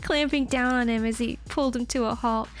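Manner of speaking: usual speaking voice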